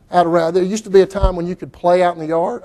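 ordinary talk

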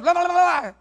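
high, loud imitated tongues babble; mock speaking in tongues. Widow speaking in tongues, mock babble. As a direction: ham up her babbling in tongues. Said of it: "she started going".

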